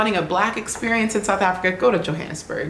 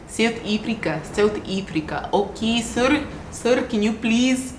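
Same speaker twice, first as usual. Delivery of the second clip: higher-pitched, cringey South African accent; exaggerated South African vowels, pitched up; attempted South African accent; 4 semitones higher than usual. Shown as a demonstration. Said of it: "the South African accent".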